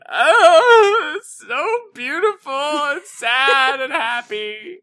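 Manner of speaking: high, hammed-up sobbing wail; mock weeping. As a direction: overcome and blubbering, opening on a loud wail. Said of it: "I'm just like".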